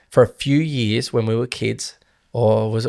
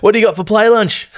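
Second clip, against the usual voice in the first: high, loud schoolkid asking eagerly; kid voice; 10 semitones higher, 7 dB louder than usual. Retold or imitated. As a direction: play an eager little kid at lunch.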